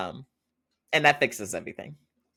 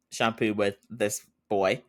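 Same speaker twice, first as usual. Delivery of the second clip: usual speaking voice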